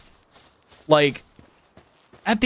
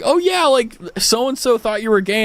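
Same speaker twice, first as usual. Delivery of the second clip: lightly voiced acquaintance, higher pitch; mock casual friend; 7 semitones higher than usual. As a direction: imitate a chatty friend, pitched up. Acting out friend of hers.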